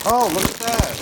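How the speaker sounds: loud, high delighted burst; excited exclaim. Real, not acted. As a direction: cheer at the unbroken shells. Shells open unbroken, delighted.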